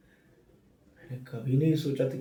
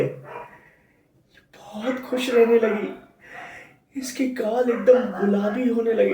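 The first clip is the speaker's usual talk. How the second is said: higher, louder, elated reminiscence; overjoyed; 8 semitones higher, 7 dB louder than usual. Acted. Husband recalling his wife's happy pregnancy, overjoyed. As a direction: relive the happiest days with rising joy. Recalling their happiest days together.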